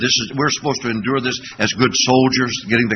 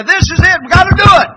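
high, loud rallying call; rallying cry; 5 dB louder than usual. Retold or imitated. Preacher rallies his people, high loud call. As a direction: fire them up to go.

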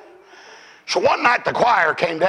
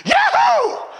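joyful yell. Retold or imitated. The choir man's first shout of praise, high yell. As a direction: burst out with a shouted yahoo. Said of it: "he started stuttering and he went".

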